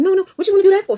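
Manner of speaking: high, mocking imitation of the boss. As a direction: mimic her whiny and high. Imitating her dismissive boss.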